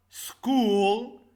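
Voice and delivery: "school" as one long, loud syllable. Shown as a demonstration. Teacher showing two vowels make one sound, "school" drawn out in one syllable. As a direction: stretch the oo in "school", high and loud. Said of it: "we pronounce it as one".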